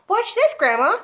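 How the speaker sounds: high, loud call to grandma; excited shout. Acted. George calls up to Grandma, high and loud. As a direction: show off to her. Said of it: "he shouted".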